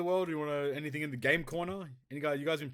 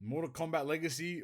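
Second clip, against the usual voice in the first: usual speaking voice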